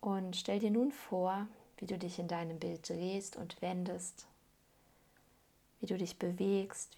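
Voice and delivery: ordinary delivery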